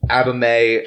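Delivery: usual speaking voice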